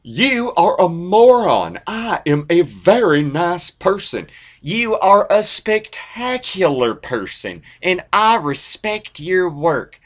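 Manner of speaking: high, loud, drawn-out gushing voice; Huell Hauser impression. Comedian showing a nice Doctor Phil, Huell Hauser impression. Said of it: "Huell Hauser is the nice Doctor Phil".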